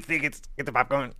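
fast crab hawker calling out. Retold or imitated. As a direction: rattle it off like a crab vendor. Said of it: "what was my crab voice".

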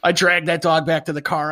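ordinary delivery

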